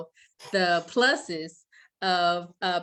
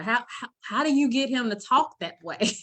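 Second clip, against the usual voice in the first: higher-pitched, stammered wonder; amazed question; 5 semitones higher, 3 dB louder than usual. Retold or imitated. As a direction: imitate astonished friends asking for the secret. Retold friends' amazed question.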